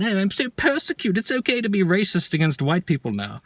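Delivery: high-pitched mock self-pity; mock victim whining. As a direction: whine it in a put-on high voice. Mocking complainers who claim persecution.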